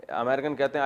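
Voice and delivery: ordinary talk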